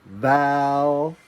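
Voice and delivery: "Valley" sung out slow with a rising end; model word. Shown as a demonstration. Teacher modelling the word for the class to repeat, drawn out. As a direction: stretch "Valley" and lift it at the end. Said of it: "Everyone say valley".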